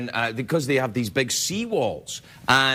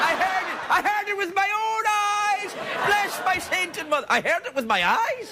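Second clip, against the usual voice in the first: high, shouted Irish leprechaun voice; hammed-up Irish brogue, much higher; mock Irish leprechaun. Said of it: "you've got a leprechaun laugh".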